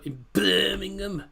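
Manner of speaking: put-on slow, half-hearted try at a Brummie accent; mock Brummie accent. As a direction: drag out Birmingham in a jokey Brummie accent. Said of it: "I definitely can't do a Brummie accent".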